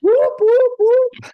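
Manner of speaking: high loud whooping; excited cheer. Real, not acted. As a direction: whoop it up like a fan. Cheering a sponsor announcement.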